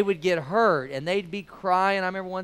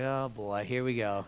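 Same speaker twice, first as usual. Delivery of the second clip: resigned sigh; 7 semitones lower, 8 dB quieter than usual. Retold or imitated. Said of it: "I'm just like".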